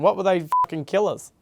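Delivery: ordinary delivery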